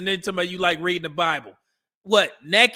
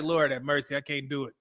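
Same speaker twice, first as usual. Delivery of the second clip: exasperated mutter; 5 semitones lower, 6 dB quieter than usual. Retold or imitated. Retelling his reaction to a girlfriend's wild kid, quiet giving up. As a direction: lower and much softer, giving up under his breath. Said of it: "I'm like".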